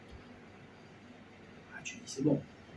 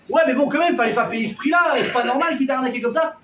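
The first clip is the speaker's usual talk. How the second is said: complaining wife; 5 semitones higher, 6 dB louder than usual. Acted. Esposa se queja de la estafa.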